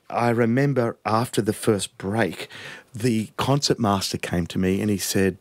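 usual speaking voice

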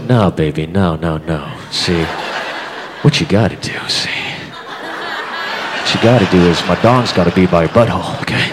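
low, smooth mock seducer voice; Johnny Casanova. Casanova teaching his date pool, low and smooth. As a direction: purr it low like a sleazy lover. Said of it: "the Johnny Casanova".

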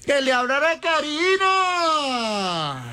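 high, long-held holler; high-pitched announcer-like wail; drawn-out call. Real, not acted. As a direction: stretch the last word out, falling slowly. Host calls out, stretched.

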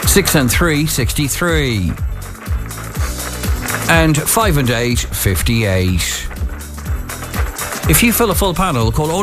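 calling each number out, then repeating it; measured bingo call with pauses; bingo caller. Acted.

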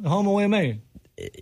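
mumbled as if without teeth; toothless voice. Pretend. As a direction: lips over gums, slurred words. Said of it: "You're talking like you have no teeth".

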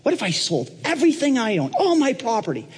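starts keen, climbs very high into grand promises; eager Donald Trump. Acted. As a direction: jump in and escalate each offer. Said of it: "Donald just jumps right in again".

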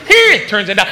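mock fight cry gone wrong; punch yelp turning to yelp. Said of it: "what you think is".

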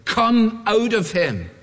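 slow, lightly voiced stern rebuke; stern command. Jesus casting out the demon, slow mild sternness. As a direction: order the demon out, firm and deliberate. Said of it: "said Jesus sternly".

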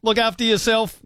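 laughing delight. Real, not acted. Host laughing, signing off caller.

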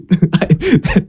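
a high, embarrassed laugh at himself; breathy high chuckle of defeat; rueful laugh. Real, not acted. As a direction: laugh off being caught out.

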